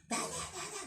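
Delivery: high squawky parrot calling her name; parrot imitation. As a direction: mimic the parrot. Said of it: "parot na ibon". (parang loro na tumatawag)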